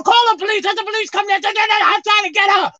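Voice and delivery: high, loud calling out to her; mock pleading to stop her; hammed-up urgent call; urgent plea. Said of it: "I just told her".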